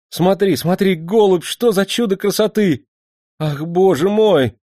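high, loud cry of rapture; cry of delight. Acted. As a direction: gasp it out in raptures. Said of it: "вскрикнул от восторга". (восторженный вскрик)

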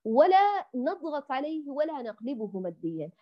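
usual speaking voice

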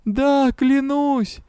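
high, slow pleading; desperate oath. A narrator's reading. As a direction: swear it in desperation.

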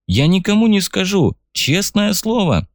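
high, pleading, frightened voice. Acted. Terrified soldier begs for his life.

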